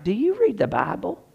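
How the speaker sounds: high, soft, mock-baffled question; incredulous question. Preacher retelling a reply to a doubting friend, incredulous. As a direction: lift it high in polite disbelief.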